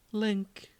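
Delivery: high, breathy Zelda calling Link; a lovestruck princess sighing his name. Acted. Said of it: "I voiced Zelda last time".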